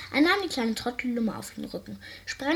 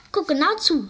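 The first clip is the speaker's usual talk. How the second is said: firm call; 6 semitones higher, 5 dB louder than usual. A narrator's reading. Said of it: "sagte er".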